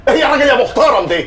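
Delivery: laughing outburst. Acted. Dazed clerk yells at intruder, laughing.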